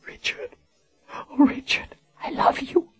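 faint breathy whisper with a cry; weak whisper. Acted. Dying Colin whispers her love to Richard. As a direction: whisper it, fading.

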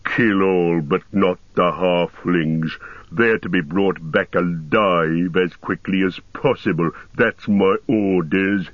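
slow, growling orc chief; deep, deliberate orc command voice. A narrator's reading. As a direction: growl orders with heavy authority. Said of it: "said a third voice in a deep growl".

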